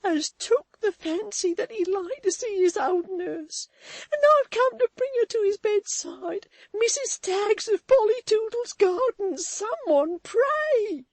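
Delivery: high, loud tearful cry. A narrator's reading. Susan wringing her hands before Walter, high tearful cry. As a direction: sob it out. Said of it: "cried Susan, wringing her hands".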